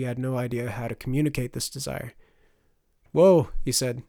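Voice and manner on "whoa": loud sharp command to a mount; firm call to halt. Acted. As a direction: call out to stop the animal. Rider halting the wolf.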